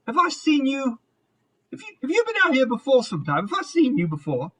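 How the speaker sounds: probing and high, half recognizing someone; questioning shopkeeper woman. Acted. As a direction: voice a curious woman sizing up a customer. GM voices woman addressing player.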